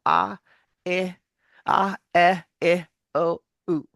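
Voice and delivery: a, e, i, o, u as short clipped vowels. Shown as a demonstration. Coach demonstrating short vowels to the student. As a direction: keep each vowel short. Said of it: "short vowel".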